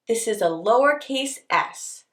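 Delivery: usual speaking voice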